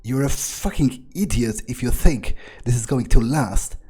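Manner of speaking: ordinary talk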